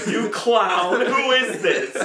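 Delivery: shouted through laughter; laughing, imitating the caller. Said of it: "this is Osborne Cox".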